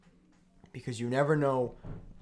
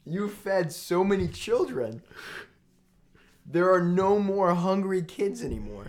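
higher, slow mock-tearful quote; mock choked up; 7 semitones higher, 4 dB louder than usual. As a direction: ham up the tears. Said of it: "I'm getting choked up".

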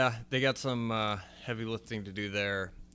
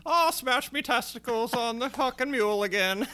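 high, loud hammed-up Irish accent; a jokey over-the-top Irish voice; mock Irish accent; 5 dB louder than usual. Podcaster mocks the show's Irish accents in a hammed-up Irish voice. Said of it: "shitty Irish accent".

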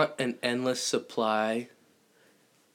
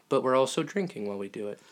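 ordinary talk

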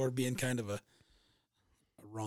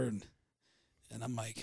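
ordinary talk, nothing marked

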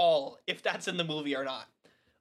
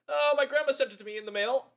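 put-on higher, quicker, amused retelling of a kid; lightly voiced kid; 6 semitones higher, faster than usual. Imitate a kid casually, higher and quick. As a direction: speaker retells the kid's reply to the villain, lightly imitated kid. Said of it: "She goes".

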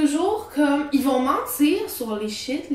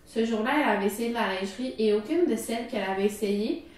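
story reading; 4 dB quieter than usual. Acted. Reads the Reddit story aloud.

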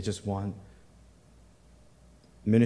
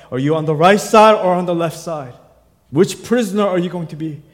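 rising, louder and higher, preaching hard; preaching at full force; 6 semitones higher, 9 dB louder than usual. Acted. Pastor pressing the congregation to decide, preaching full force. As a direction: drive the choice home from the pulpit.

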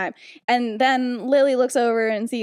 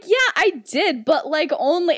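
higher-pitched mock defensiveness; defensive admission; 5 semitones higher, 3 dB louder than usual. James admits the spell to McGonagall, high and defensive. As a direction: own up in a raised, excusing voice. Boy admits prank to teacher.

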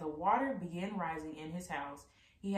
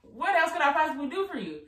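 exasperated God; 5 semitones higher, 5 dB louder than usual. A narrator's reading. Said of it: "God replied".

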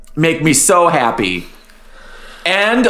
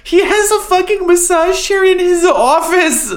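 incredulous. Real, not acted. Incredulous exclaim.

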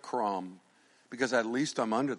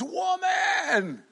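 a sudden high shout of woman; exclaiming. Acted. Jesus answering the woman, a high astonished shout. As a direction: cry it out in amazement. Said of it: "He exclaims".

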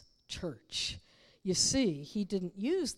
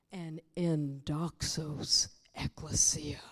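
hushed preaching; 4 semitones lower than usual. Real, not acted. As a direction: let each phrase settle, with long pauses.

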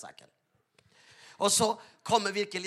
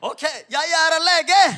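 much higher and louder, slowed, proclaiming it; bold declaration; 9 semitones higher, 7 dB louder, slower than usual. Retold or imitated. Pastor declares himself a doctor to the passengers, loud. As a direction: announce it to the whole cabin.

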